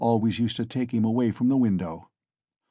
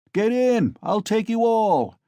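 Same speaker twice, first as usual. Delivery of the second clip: loud, high bragging bellow; swaggering shout; drunken shout; 11 semitones higher, 4 dB louder than usual. A narrator's reading. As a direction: roar it from the cart. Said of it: "Mikolka shouted again".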